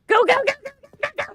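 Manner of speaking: high, very loud panicked urging; frantic urging. Real, not acted. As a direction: yell it in a real rush of excitement. Racing against time in game.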